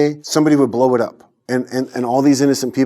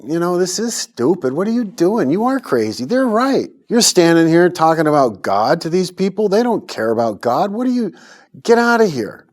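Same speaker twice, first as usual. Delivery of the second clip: self-scolding; 6 semitones higher than usual. Retold or imitated. Street preacher scolds himself after hours, exasperated. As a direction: berate yourself for being a fool.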